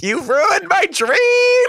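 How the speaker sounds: high, drawn-out melodramatic cry; over-the-top crushed-child howl; mock wailing. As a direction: wail it out slowly, hugely hammed-up. Mock outburst at dad over audition.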